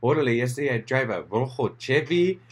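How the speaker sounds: lilting, showy Chicano accent. Shown as a demonstration.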